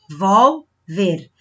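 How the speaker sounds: Revolver split slowly into RE, VOL, VER; syllable by syllable. Shown as a demonstration. Teacher showing paroxítonas, Revolver slowly split by syllable. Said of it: "vai ser a penúltima sílaba mais forte".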